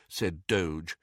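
usual speaking voice

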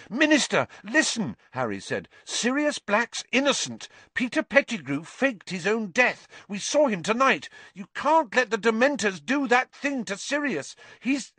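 high-pitched, urgent appeal; urgent pleading. A narrator's reading. Boy begging Minister to spare Sirius, urgent. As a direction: plead to be believed before it is too late.

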